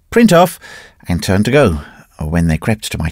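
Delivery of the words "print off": sharp loud call across the press room; cried out. A narrator's reading. Narrator calling out to the printers, loud. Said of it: "I cried".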